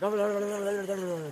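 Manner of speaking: high, loud mock chatter of a frantic mind; busy-minded chatterer. Mocking people with crazy minds, high loud babble. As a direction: mimic someone whose thoughts race everywhere. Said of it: "talk like that".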